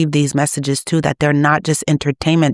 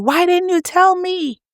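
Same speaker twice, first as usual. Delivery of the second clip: much higher, anguished cry of reproach; desperate wailing plea; 14 semitones higher than usual. Retold or imitated. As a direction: wail it up high like someone lost and terrified. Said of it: "your loved ones are screaming at you".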